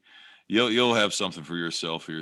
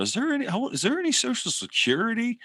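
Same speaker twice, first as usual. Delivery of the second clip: high, prim mock news-anchor voice, much higher; mock anchorwoman. Host mocks a morning-news anchor asking a silly question, in a high mock voice. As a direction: ham up a baffled TV host's question. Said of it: "has a look on her face going".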